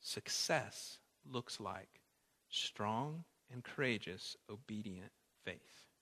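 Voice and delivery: soft, slow reflective repetition; quiet slow refrain. Shown as a demonstration. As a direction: repeat it gently and slowly. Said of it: "repeat this point".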